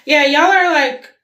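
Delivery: excited. Real, not acted. Lively start on the reading.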